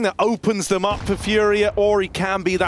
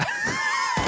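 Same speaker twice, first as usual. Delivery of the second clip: burst of laughter; 5 dB quieter than usual. Real, not acted. Laughing at a teammate's jab.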